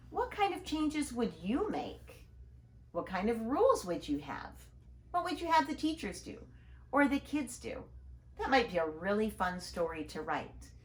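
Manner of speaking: ordinary delivery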